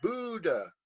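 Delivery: "Buddha" said BOO-duh, long oo; pronounced with oo. Shown as a demonstration. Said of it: "with a oo".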